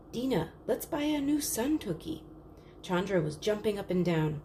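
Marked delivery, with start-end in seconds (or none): 0.1-2.2 put-on high, eager little-girl plea; eager child. Chandra begs her sister Dina to buy the lamp, eager and higher. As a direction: beg your sister, bright and higher.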